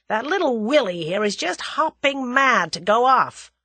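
forceful American comeback; retort. A narrator's reading. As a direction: press the threat home forcefully. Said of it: "retorted Julius".

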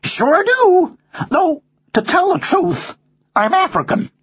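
high-pitched American parrot; high, easygoing American drawl; American accent. Acted. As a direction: answer in a high American bird voice. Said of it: "you have an American accent".

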